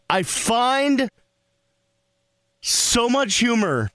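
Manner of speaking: ordinary delivery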